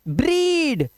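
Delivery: "ब्रीड" high with held ee; long vowel. Shown as a demonstration. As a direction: stretch the ee in "ब्रीड". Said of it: "long vowel sound होता है".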